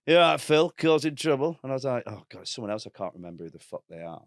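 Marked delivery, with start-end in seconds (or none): 0.1-1.6 higher, louder, matey greeting; cheery stranger. Retold or imitated. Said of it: "this guy went". 2.1-4.2 much softer, private worried thought; inner panic. Retold or imitated.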